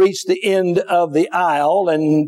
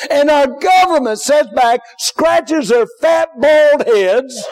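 fiery preaching; 5 semitones higher than usual. Acted. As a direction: preach it hard and build. Preacher building to full force.

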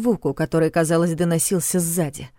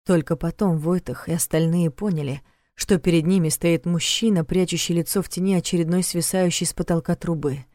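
ordinary delivery

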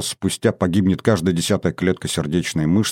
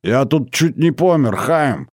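put-on higher, grumbling old-man scold; grumpy old man; 6 semitones higher than usual. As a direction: grumble like a cranky grandfather. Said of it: "сварливо проговорил".

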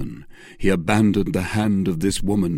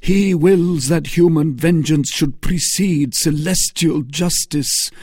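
high, louder exultant cry; exultation; 9 semitones higher, 5 dB louder than usual. Acted. Felton exulting over vengeance. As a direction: triumph in it. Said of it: "with increasing exultation".

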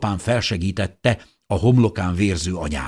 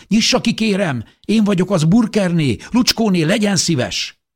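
high, loud, insistent begging; urgent pleading; 12 semitones higher, 6 dB louder than usual. A narrator's reading. Mother begs neighbour to open door, urgent shouting. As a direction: plead loudly to be let in. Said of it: "kopogtatott édesanyám sürgetően".

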